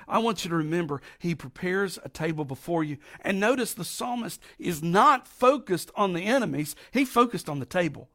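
ordinary talk, nothing marked

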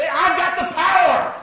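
high, loud, slow shout of power; congregational shout. Real, not acted. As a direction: lead the crowd in a big call-out.

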